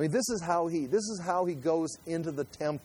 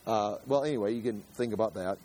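ordinary talk

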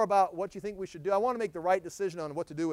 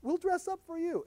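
high, mocking put-on voice; sing-song mock plea; mock pleading Israel; 12 semitones higher, 3 dB louder than usual. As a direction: whine it like a fickle lover who changed her mind. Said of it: "It's like".

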